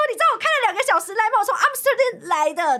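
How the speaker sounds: high-pitched, half-laughing retold protest; indignant protest. Customer telling staff she drove two hours, mock indignation.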